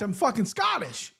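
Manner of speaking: ordinary delivery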